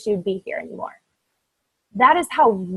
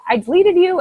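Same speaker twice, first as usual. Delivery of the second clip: playful quote; 8 semitones higher, 5 dB louder than usual. Retold or imitated. Laughing quote of coaches.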